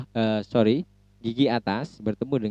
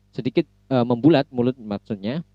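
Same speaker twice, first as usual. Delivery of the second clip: ordinary talk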